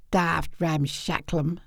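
low, slow gruff uncle; Uncle Art. Acted. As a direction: drawl it deep and slow. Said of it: "says Uncle Art".

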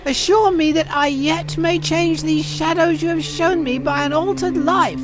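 high, desperate plea; imploring old miser; pleading Scrooge. Acted. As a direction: beg earnestly, pitched well above narration.

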